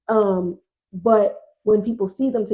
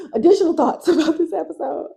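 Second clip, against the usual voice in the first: higher voice breaking into real laughter; laughing; 7 semitones higher, faster than usual. Real, not acted. Podcaster introducing additional thoughts on the episode, laughing. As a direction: laugh through the announcement.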